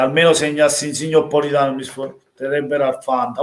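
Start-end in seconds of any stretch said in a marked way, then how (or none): none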